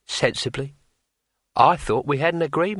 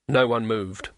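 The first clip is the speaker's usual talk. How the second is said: ordinary delivery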